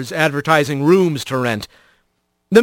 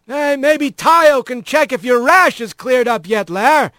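higher, heckling dock rat; teasing coworker; 6 semitones higher than usual. A narrator's reading. Dock rat to Laris over the flirting, heckling. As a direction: call it out to needle her.

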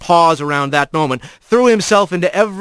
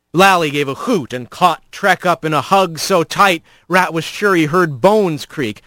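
ordinary delivery

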